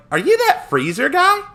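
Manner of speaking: high, loud cartoon hero question; abridged Goku. Hero shows up to fight Frieza, high loud Goku voice. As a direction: pitch it way up, rising at the end. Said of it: "when he shows up to fight Frieza".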